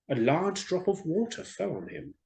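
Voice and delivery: ordinary delivery, nothing marked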